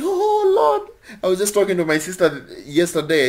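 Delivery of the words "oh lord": high, wailing mock sob of grief; mock crying wail. Preacher mimics a weeping believer crying out, high mock sob. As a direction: imitate someone crying out to God. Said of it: "crying and crying".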